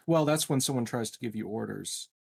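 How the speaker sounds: ordinary talk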